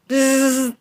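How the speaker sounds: ordinary talk, nothing marked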